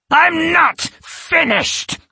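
very high, slow furious cry; barely restrained rage. A narrator's reading. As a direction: rage barely held back. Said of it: "with barely restrained rage".

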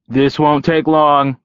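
drawn-out breezy promise; cheerful call. A narrator's reading. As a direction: call it out brightly to the group. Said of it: "he called cheerfully over his shoulder".

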